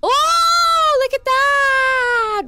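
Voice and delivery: high, loud, drawn-out amazement; awed cry. Real, not acted. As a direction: cry out in awe. Awed outburst in game.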